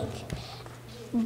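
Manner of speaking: barely breathed, no voice; soft unvoiced murmur; whisper. Real, not acted. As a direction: whisper the word to yourself. Nervous child repeats word.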